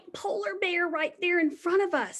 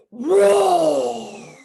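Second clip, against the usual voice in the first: big loud growling roar; lion roar; 11 dB louder than usual. Pretend. Teacher demonstrates the lion roar for preschoolers, loud. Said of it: "roar like a lion, really loud".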